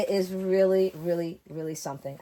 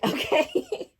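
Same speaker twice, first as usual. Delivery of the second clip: laugh, then a very high "okay?"; amused high-pitched okay; 3 dB louder than usual. Real, not acted. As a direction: giggle and squeak the question up.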